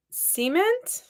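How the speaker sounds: "Cement?" as SEE-ment, rising high; SEE-ment pronunciation. Retold or imitated. Counselor answers laughing campers, "Cement?" as high SEE-ment. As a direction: question "Cement?" with first-syllable ee. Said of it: "I said the word cement".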